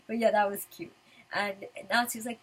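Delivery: ordinary talk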